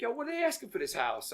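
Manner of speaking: quick, high-pitched impersonation; eager gang boss. Retold or imitated. Gang boss asks bank worker about the house, eager high retelling. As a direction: voice the boss's eager question, high and fast.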